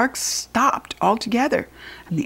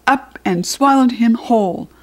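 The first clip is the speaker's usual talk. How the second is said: ordinary delivery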